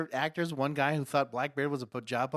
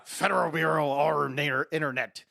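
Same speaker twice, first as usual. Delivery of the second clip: loud, hammed-up pirate proclamation; mock-official pirate bellow; pirate FBI agent; 4 dB louder than usual. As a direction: boom it out like a pirate lawman. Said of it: "if an F B I agent came in and said".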